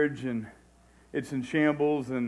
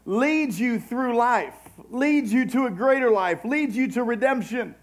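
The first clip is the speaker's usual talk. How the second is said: raised voice of a preacher driving the promise home; impassioned preaching; 7 semitones higher than usual. Real, not acted. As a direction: preach it with rising urgency, leaning on key words. Preaches with raised, stretched phrases.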